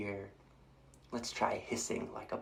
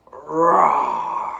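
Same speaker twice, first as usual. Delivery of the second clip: big loud roar; loud lion roar for the kids; 8 semitones lower, 13 dB louder than usual. Shown as a demonstration. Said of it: "Let's try roaring like a lion".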